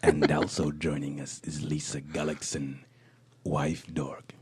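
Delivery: low breathy podcast whisper; whispered intro. Acted. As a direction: whisper the welcome slowly.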